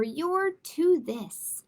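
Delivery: ordinary delivery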